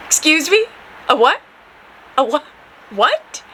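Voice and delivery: short, rising questions of disbelief; stunned disbelief. Retold or imitated. Driver told she has crossed into Mexico, incredulous. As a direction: ask it as if you cannot believe your ears.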